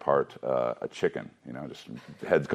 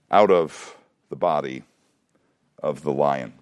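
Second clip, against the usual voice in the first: reading the verse aloud, a little higher; scripture reading; 5 semitones higher than usual. Acted. Teacher reading Samson's story aloud to his class, steady reading voice. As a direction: read the Bible passage to the class.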